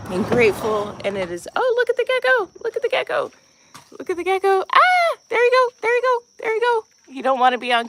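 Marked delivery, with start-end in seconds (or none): 1.6-4.6 high, bright calling out to a gecko; excited gecko spotting. Real, not acted. 4.7-6.8 high, quick cooing; coaxing the gecko. Real, not acted.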